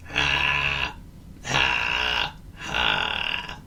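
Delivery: heavy grunted laugh while trudging; plodding grunt. A narrator's reading. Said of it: "he grunted as he plodded along".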